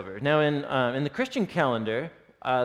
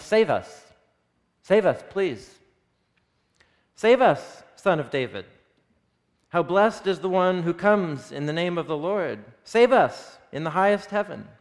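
higher, louder pleading cry; crowd shouting; 4 semitones higher, 4 dB louder than usual. Acted. The crowd calling to the Son of David, loud pleading cry. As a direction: cry out like a crowd begging to be saved. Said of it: "they were all shouting".

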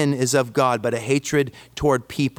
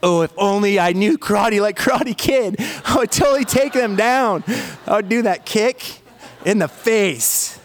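dreaming of karate revenge, pitched way up; excited boyish fantasy; 7 semitones higher than usual. Retold or imitated. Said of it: "I'm just like".

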